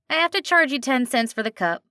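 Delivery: a friendly diner waitress with a slight twang; a hint of a nasal twang. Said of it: "slight twang to it".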